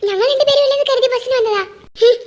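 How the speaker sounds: ordinary talk, nothing marked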